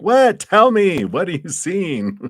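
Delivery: eager viewer. Retold or imitated. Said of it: "and you're like".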